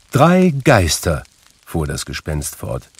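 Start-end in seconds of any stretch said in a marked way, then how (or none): 0.1-1.2 lightly voiced spectre, high and loud; a hint of ghostly proclamation; portentous ghost. A narrator's reading. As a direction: announce it ominously. Narrator voices Marley's ghost.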